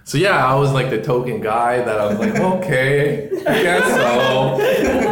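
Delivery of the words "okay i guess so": high, laughing reluctant agreement. Retold or imitated. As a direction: giggle it out slowly. His own retold reply.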